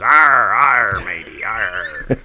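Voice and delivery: a playful pirate "arr"; a light movie-pirate growl; mock pirate accent. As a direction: ham up a Talk Like a Pirate Day pirate. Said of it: "Everybody kind of says".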